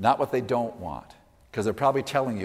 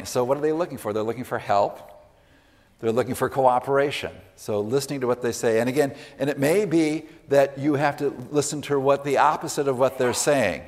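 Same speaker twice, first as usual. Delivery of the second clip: ordinary delivery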